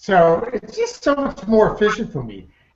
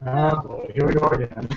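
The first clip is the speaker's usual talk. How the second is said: weary; 5 semitones lower, 4 dB quieter than usual. Retold or imitated. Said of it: "And now it's just like".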